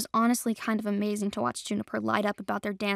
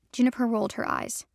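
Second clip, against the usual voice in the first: usual speaking voice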